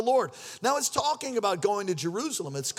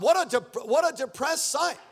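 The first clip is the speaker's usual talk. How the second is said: ordinary delivery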